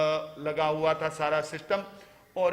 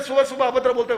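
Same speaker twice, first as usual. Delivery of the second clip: raised, loud acted outrage; indignant scolding; 6 semitones higher, 8 dB louder, faster than usual. Oilman offended by rude morning talk, loud indignation. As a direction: tell him off loudly, voice pitched up.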